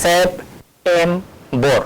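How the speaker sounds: slow, deliberate second take; slow syllable demo. Shown as a demonstration. Teacher repeating the word slowly for students. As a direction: pull each syllable apart slowly. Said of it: "ওই সাউন্ডটা কিরকম হচ্ছে".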